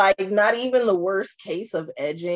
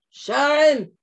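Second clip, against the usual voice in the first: mock [name] call; 5 semitones higher, 5 dB louder than usual. Mimicking [name] calling for Sharon.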